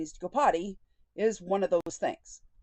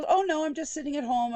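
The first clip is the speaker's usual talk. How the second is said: mock innocent denial; 7 semitones higher, 3 dB louder than usual. Mimicking therapist's lie.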